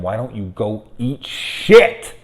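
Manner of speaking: imitated mother's outburst, loud, high and slow; mock yell at the kid, words drawn out; retold scream. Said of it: "turns around and screams at him".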